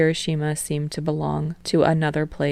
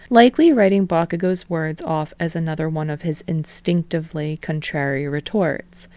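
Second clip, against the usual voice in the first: ordinary delivery, nothing marked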